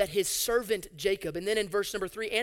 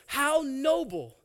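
slow, higher mock admiration; sarcastic; 5 semitones higher, much slower than usual. Preacher scoffing at Jacob hiding south, slow sarcasm. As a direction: draw it out with scorn.

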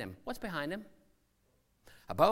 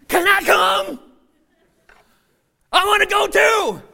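eager shout; 13 semitones higher, 17 dB louder, slower than usual. Acted. Peter begging to join Jesus.

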